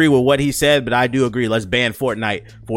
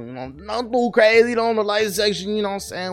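higher playful voice; mock high voice; 8 semitones higher than usual. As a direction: pitch it up for fun.

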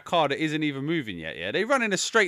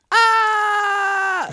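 sudden high shrieking scream, much higher and louder; mock scream; 8 dB louder than usual. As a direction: imitate her frozen scared shriek. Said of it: "looks at him for about three seconds and goes".

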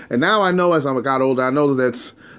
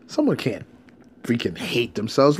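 confused disbelief; 5 dB quieter than usual. Retold or imitated. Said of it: "I'm like".

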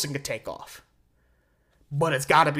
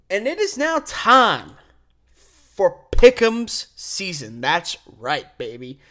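slow, louder announcer voice; hype announcer; 5 dB louder, slower than usual. Acted. Host kicking off Pick'em season to listeners, hyped announcer. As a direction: drag it out like a stadium announcer.

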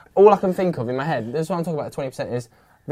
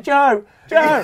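calling out; 11 semitones higher, 7 dB louder than usual. Retold or imitated. Friend calling out while running past.

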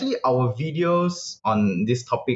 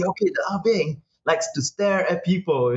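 high lecturing expert voice; mock expert voice; 6 semitones higher than usual. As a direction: play the pompous expert. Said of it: "I come in as expert, say".

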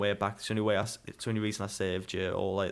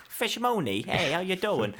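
mock gangster greeting; 9 semitones higher, slower than usual. Mock voicing character.

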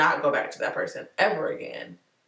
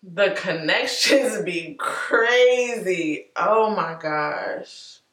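amazed; 3 semitones higher, 5 dB louder, much slower than usual. Real, not acted. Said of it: "I needed that just now".

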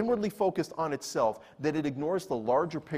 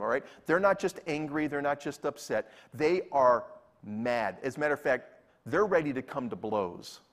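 ordinary talk, nothing marked

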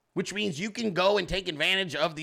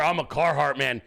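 lower, lightly voiced macho Carhartt man; mock tough guy; 6 semitones lower than usual. Teasing Hog for posing as a rugged Carhartt man, lightly mocking. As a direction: puff it up like a wannabe tough outdoorsman. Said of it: "Fucking pretending he's out here".

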